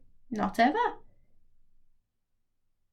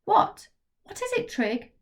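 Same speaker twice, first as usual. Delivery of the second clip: alarmed question; 11 semitones higher, 4 dB louder than usual. Acted. Maggie asks worried friend.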